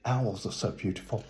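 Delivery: ordinary delivery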